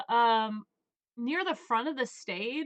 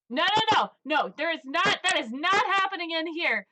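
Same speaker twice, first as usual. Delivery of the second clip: loud, higher shout shutting the brawl down; 6 semitones higher, 4 dB louder than usual. Acted. Rory shouting down the rude patrons, loud and high. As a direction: yell it to stop them cold. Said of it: "just shouts at them".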